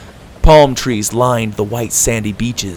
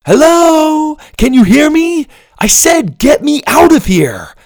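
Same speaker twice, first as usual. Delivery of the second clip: shouting; 7 dB louder than usual. A narrator's reading. Boy shouts in the dark.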